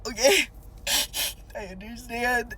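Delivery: a touch of hammed-up sniffling; mock tearful. Sobbing driver accepts cop's explanation, mock tearful. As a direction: give in through pretend sobs.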